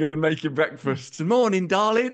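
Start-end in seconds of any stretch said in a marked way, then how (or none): 1.2-2.1 high sing-song cheerful partner, mocking; breezy too-happy morning greeting; teasing impression of a whistling, cheery spouse; chirpy morning voice.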